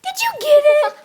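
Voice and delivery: high excited dog praise; talking to a dog. Real, not acted. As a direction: squeal praise at the puppy.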